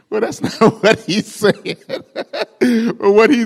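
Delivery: laughing through the words. Real, not acted. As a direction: crack up at the punchline.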